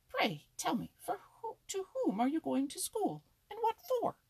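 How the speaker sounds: very high, loud squirrel voice; mocking interrogation. A narrator's reading. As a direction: demand it high and loud, ridiculing him. Squirrel mocks the rabbit's schooling.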